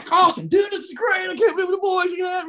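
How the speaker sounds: high, gushing, thrilled; imitated excitement. Jimmy in the car with his friends, high excited gushing. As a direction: rave about the boys like a happy fool.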